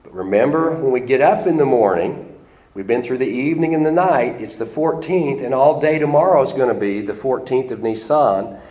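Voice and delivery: normal talking voice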